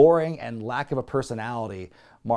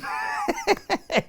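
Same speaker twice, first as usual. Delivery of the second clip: unvoiced high giggle; breathy laugh. Real, not acted. Host amused by the nickname, breathy giggle. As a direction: wheeze with laughter.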